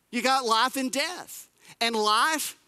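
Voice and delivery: usual speaking voice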